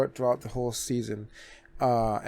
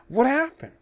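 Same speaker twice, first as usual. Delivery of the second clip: surprised; 12 semitones higher, 4 dB louder than usual. Real, not acted. Echoing own question, much higher.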